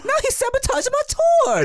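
put-on high, laughing Japanese accent; mock Japanese accent. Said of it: "my Japanese accent came off kind of Italian".

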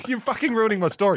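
high, loud mock outrage; mock indignant. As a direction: play up the indignation, laughing. Quoting Mason's joke.

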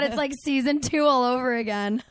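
ordinary talk, nothing marked

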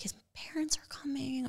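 breathless hushed whisper; whispered realization. Retold or imitated. Telling the host how she realized, whispered. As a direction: whisper it as the penny drops.

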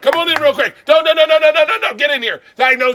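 loud, high hype-man greeting; host hollering a buddy in; excited welcome. Real, not acted. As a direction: pump up the room for a friend arriving. Welcoming Big J loudly.

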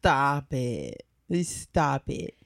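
low, flat stop it; deadpan stop it. Retold or imitated. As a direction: cut them off, low and flat. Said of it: "I'm like".

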